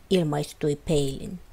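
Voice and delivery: ordinary delivery, nothing marked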